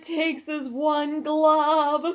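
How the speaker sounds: high, loud and very slow, like a trailer line; milk every word for drama; booming slow-motion movie quote; dramatic movie voice. Pretend. Co-host cuts in with dramatic line.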